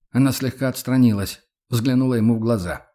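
plain reading voice

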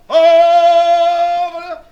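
loud sung cry. Shown as a demonstration. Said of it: "Et comme si l'on criait bien fort".

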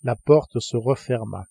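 ordinary delivery

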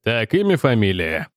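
lightly gruff official question, falling away low; a touch of brusque policeman taking details; gruff grunt. A narrator's reading. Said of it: "буркнул полицейский с блокнотом".

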